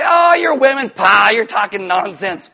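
loud, hammed-up scoff with drawn-out words; dismissive scoffing. Disciples dismissing the women's news, mock scornful outburst. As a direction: brush them off as talking rubbish.

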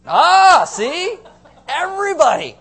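big, loud, high "Ah, see?" catching the crowd out; triumphant gotcha. Real, not acted. Pastor springing his trap on the married congregation, loud triumphant gotcha. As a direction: crow it like you just won the bet. Congregation caught by hand raise.

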